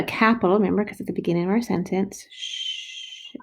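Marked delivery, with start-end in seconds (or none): 2.3-3.3 unvoiced soft sh sound; teacher modeling sh; hissed sh. Shown as a demonstration. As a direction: breathe out a long sh. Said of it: "S H goes".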